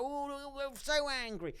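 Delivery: mock angry. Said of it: "they was just like".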